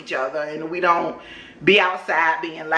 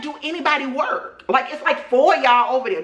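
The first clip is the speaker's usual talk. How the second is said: incredulous; 6 semitones higher than usual. Retold or imitated. Mocking the idle neighbors.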